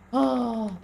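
usual speaking voice

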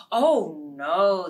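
loud startled oh no; loud denial. Acted. Animals denying to the fox, loud. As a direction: blurt out no.

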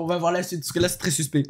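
ordinary talk, nothing marked

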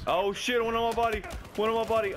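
high alarmed yell, enemy on you; panicked shout. Real, not acted. Enemy right on top of him.

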